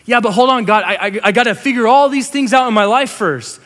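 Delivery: high, loud, stammering objection; acted overwhelmed pleading; flustered protest. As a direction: push back in a worked-up fluster. Voices flustered believer protesting to God.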